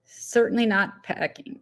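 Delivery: ordinary delivery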